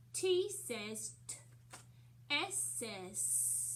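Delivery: slow phonics chant, each isolated sound set apart; name the letter, then slowly give its pure sound; letter-sound drill. Shown as a demonstration. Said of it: "go through our letter cards".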